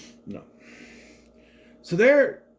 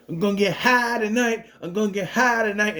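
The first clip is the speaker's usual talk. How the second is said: higher-pitched mock whine of partying friends; mock complaining; 6 semitones higher than usual. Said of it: "they're just complaining".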